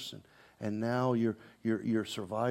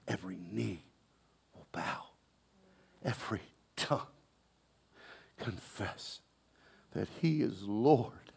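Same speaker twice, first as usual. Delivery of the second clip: whispered, softer, in awe; hushed whisper; 3 semitones lower, 5 dB quieter than usual. Acted. Preacher hushing the church for the verse, whispered. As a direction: breathe the verse out like a secret. Awed scripture recital.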